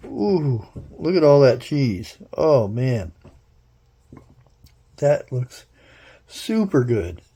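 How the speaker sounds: normal talking voice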